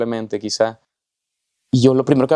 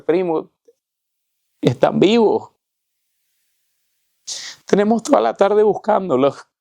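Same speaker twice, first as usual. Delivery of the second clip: high, choked-up retelling of a crying man; tearful; 8 semitones higher than usual. Retold or imitated. Weeping cousin embracing the one he found, high tearful voice. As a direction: voice climbing as if through tears. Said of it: "él está llorando profusamente".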